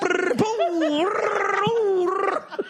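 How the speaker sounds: high trilled rolls broken by a boom; imitated tumbling noise. Comic showing elbow hits launching the skater, high imitated trills. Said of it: "He'd go".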